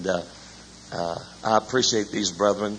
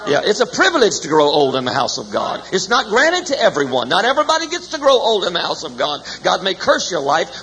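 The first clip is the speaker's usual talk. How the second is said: rising, louder preacher's cry on the key line; preaching at full force; 6 semitones higher, 5 dB louder than usual. Acted. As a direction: build the sermon to a loud, high climax.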